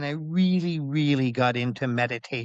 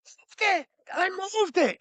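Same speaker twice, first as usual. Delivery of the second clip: high-pitched excited yelling; shrieking the big news; retold scream; slower than usual. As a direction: cry out in amazed triumph, far higher. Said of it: "I scream".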